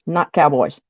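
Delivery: ordinary delivery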